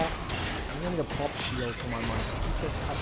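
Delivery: usual speaking voice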